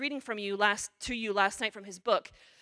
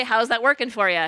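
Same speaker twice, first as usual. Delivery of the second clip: louder, a hint of Doctor Phil; Doctor Phil quip; 9 dB louder than usual. Preacher quoting a TV host about avoidance, lightly voiced. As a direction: toss the famous line at them. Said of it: "as Doctor Phil would say".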